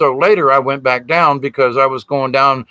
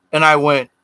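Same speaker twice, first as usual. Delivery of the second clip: usual speaking voice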